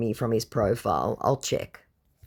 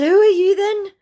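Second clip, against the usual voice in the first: sceptical challenge; 8 dB louder than usual. Retold or imitated.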